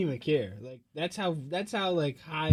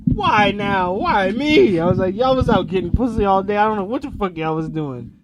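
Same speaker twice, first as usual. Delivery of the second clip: loud frustrated whine; 3 semitones higher, 12 dB louder than usual. Retold or imitated. Frustrated complaint retold.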